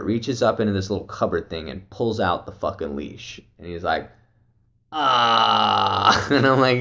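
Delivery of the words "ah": long, held "ahh"; imitated discovery "ah". Friend pulling out the lost leash, imitated drawn-out "ah". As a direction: draw out one open "ah", easing down.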